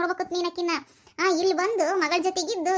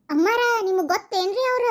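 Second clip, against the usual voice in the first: high, loud question; loud shouting talk; 4 semitones higher, 4 dB louder than usual. Acted. Woman asking her friend whether she knows the passerby, loud shouting talk. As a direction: shout the question at your companion. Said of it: "ಅದ ಮತ್ತೆ ಜೋರ್ ಜೋರ್ ಕೂಗ್ ಮಾತಾಡ".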